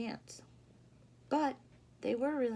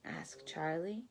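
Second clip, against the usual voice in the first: ordinary delivery, nothing marked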